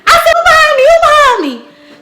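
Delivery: high, loud panicked shriek; dramatic scream. Retold or imitated. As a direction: shriek it like someone is right behind you. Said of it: "I'm dramatic".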